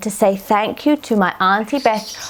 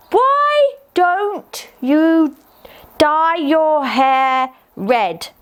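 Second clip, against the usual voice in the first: very slow and much higher; slow dictation; 7 semitones higher, much slower than usual. Pretend. Child dictating a cheeky line to her aunt, very slow. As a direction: draw out each word as you write.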